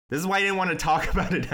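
loud, fast, laughing rant; shouting. Real, not acted. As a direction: yell it through a laugh. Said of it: "I'm sorry I'm yelling at everyone today".

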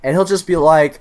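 usual speaking voice

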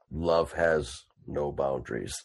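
low, quiet and slow brute; solemn simple giant's rumble; slow, deep proclamation of love; Boom Boom voice. Acted. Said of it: "He goes".

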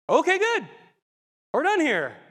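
high, loud, self-satisfied wrap-up; curt conclusion. Retold or imitated. Teen to God after being agreed with, loud dismissal. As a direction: shut the argument down triumphantly.

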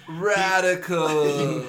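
drawn-out high surfer drawl. Pretend. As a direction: stretch it like a stoned surfer. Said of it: "It's like".

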